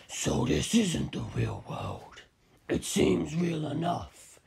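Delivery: deep, slow, menacing giant; towering fighter greets the hero in a deep slow voice; Kaz voice. A narrator's reading. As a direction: loom over him with a low slow growl. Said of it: "he said".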